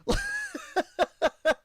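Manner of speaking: sudden squeaky laugh; a real laugh at his own sarcasm; high burst of laughter. Real, not acted. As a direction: crack up high at your own joke.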